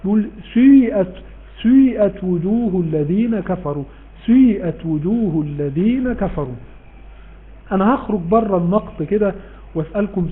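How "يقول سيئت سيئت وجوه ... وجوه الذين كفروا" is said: سيئت with the سين both rounded and broken; ishmam on sin. Shown as a demonstration. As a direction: say سيئت mixing damma and kasra on the سين. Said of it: "فبيضموها ويكسروها في نفس الوقت".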